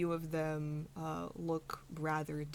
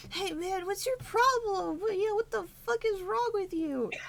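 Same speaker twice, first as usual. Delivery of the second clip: much higher, squeaky angry little halfling; angry halfling friend; 7 dB louder than usual. Acted. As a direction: snap at the stranger like a cocky little halfling. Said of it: "you see one go".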